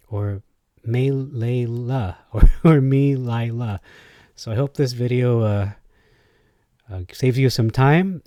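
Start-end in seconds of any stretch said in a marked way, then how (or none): 0.9-2.2 "Melela" slowly as may-lay-LA; pronounced may-lay-la. Shown as a demonstration. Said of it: "put a different emphasis on words". 2.8-3.8 "Melila" slowly as mee-lie-la; pronounced mee-lie-la. Shown as a demonstration. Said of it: "key of pronunciation are they using".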